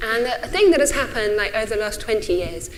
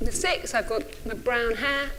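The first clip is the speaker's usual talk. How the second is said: ordinary delivery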